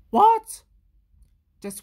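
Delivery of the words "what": very high, loud what; outraged what. Acted. Louis outraged at the story's line. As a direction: shriek it in disbelief.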